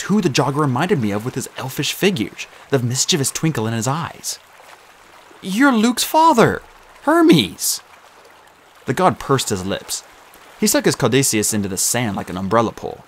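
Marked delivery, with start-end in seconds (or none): 5.4-7.8 high, slow realization; stunned realization. Acted. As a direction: blurt out the discovery. Percy realizing.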